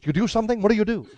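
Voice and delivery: intense, high-pitched questioning; Albert Brooks. Acted. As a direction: do his suddenly intent interrogation. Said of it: "and he got really intense".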